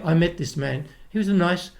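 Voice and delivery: usual speaking voice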